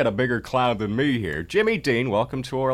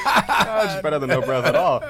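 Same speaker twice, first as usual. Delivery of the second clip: higher, louder words through laughter; laughing; 6 semitones higher, 5 dB louder than usual. Real, not acted. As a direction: laugh out the comeback. Quips while laughing.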